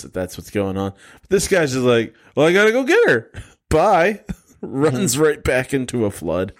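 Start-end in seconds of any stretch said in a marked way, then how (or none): 2.4-4.2 much higher, breezy and louder; chirpy, cheerful goodbye; mock husband. Mocking the husband running into the flood, chirpy.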